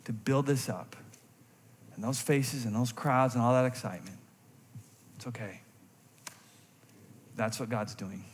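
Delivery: John softly accepting his own decrease, a hint of sorrow; pained resignation. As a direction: let it go gently, aching but at peace. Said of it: "had a little bit of pain when he said that in his voice".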